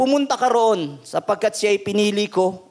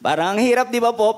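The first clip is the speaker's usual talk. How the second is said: laughing; 3 semitones higher than usual. Real, not acted. Preacher laughs at hard idea.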